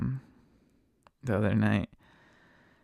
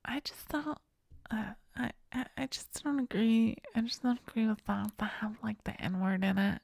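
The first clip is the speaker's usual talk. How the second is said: high, whispery, hesitant mock voice; lady passenger; 10 semitones higher, 5 dB quieter than usual. Lady objecting to the lyrics, high hushed imitation. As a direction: imitate a timid woman, soft and high. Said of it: "then she's just like".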